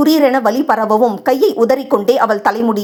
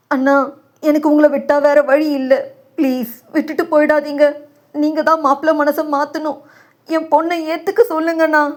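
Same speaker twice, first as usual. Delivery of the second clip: tearful plea; 4 semitones higher than usual. A narrator's reading. Desperate father begs Nathan to sway the groom, tearfully. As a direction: plead through tears, voice lifted. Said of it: "கண்ணீரில்".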